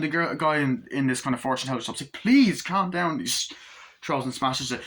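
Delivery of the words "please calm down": drawn-out, higher plea; acting out an urgent appeal for calm; imitated pleading. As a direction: plead slowly and loudly as a character. Fortune teller soothing angry girl, retold.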